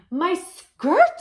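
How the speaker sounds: high, loud shriek of dismay; screaming lady. Acted. Splashed lady screams at the dirty taxi, loud and high. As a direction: scream it like your skirt just got soaked. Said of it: "screamed the lady".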